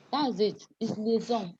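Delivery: ordinary talk, nothing marked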